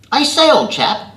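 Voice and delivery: put-on high, loud, plummy British upper-class accent like an old lord; posh British accent of an eighty-year-old lord. Telling off the swearing bloke on the bus in an upper-class British accent. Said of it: "the upper-class British accent I've adopted".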